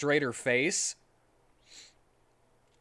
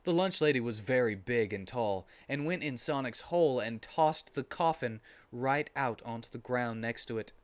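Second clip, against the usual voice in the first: plain reading voice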